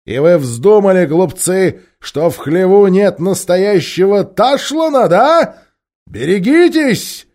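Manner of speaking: loud, slow threatening commander; menacing captain, loud; loud menacing Tarkaan; stern commander. Acted. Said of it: "загремел Рижда Тархан".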